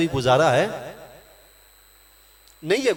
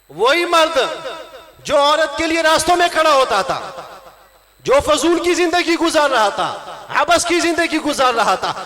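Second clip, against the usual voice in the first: higher-pitched, emphatic sermon delivery; raised, ringing preacher's voice; impassioned preaching; 8 semitones higher, 4 dB louder than usual. Real, not acted. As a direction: drive the point home to a congregation. Preaches at full force.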